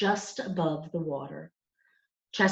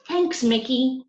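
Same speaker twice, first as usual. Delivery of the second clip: put-on grateful boy; 7 semitones higher, 4 dB louder, slower than usual. A narrator's reading. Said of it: "said Mario".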